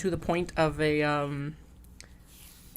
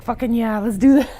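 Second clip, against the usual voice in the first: louder, hyped up cheer; pumped up; 8 semitones higher, 8 dB louder than usual. Retold or imitated. Runner hyped for beer at the finish, mock pumped cheer. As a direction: psych yourself up for the race.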